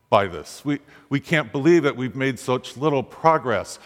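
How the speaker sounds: usual speaking voice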